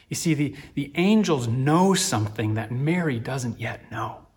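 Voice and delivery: ordinary talk, nothing marked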